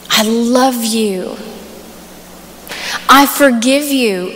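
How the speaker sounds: quoting a heartfelt proposal, unhurried; tender sincerity. Retold or imitated. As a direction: speak it earnestly, leaving long pauses. Retells husband's tender proposal words.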